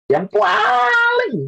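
"paling" held very long on a high note; emphatic drawn-out word. Real, not acted. Preacher asking who hurt you most, "paling" hugely drawn out. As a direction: stretch the first vowel of "paling" way out.

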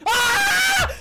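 very high, loud shriek; sudden scream of fright. Real, not acted. Player caught off guard by the game, screaming. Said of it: "screaming like a girly girl".

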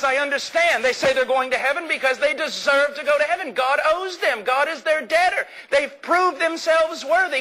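a baffled reporter who cannot believe his ears; astonished reporter. Retold or imitated. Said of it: "the reporter says".

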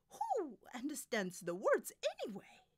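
high, loud diva retort; dismissive soprano. Acted. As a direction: brush off the objection loudly.

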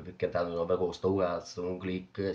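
ordinary talk

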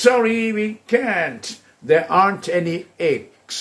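high and drawn out, words spaced; slow drill model; slow model answers. Shown as a demonstration. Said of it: "นักเรียนบอก".

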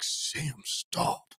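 hushed, half-whispered mock warning to a friend; hushed pleading. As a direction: imitate an urgent whispered plea from the back seat. Friends pleading from back seat.

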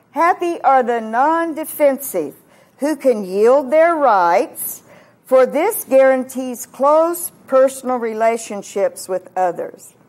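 loud, slow pulpit proclamation; forceful preaching. Acted. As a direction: hammer out each phrase slowly and loudly. Proclaims a paraphrased beatitude.

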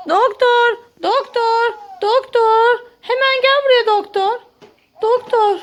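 calling out. Pretend. Mother calling the doctor to her feverish baby, urgent call.

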